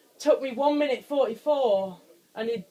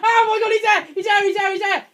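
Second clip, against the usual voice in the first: high, loud frantic yells; panicked shouting; 11 semitones higher, 9 dB louder than usual. Real, not acted. Gamer seeing the monster close, yelling in panic. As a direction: repeat it in a frantic rush.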